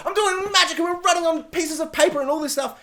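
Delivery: high-pitched, gushing impression; mock excited character. Reviewer mimicking character doing magic, mock excited. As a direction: prattle high in mock enthusiasm.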